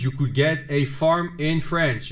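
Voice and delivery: ordinary delivery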